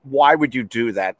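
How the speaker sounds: ordinary talk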